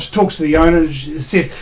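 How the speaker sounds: ordinary talk, nothing marked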